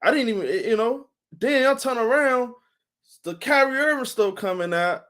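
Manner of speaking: usual speaking voice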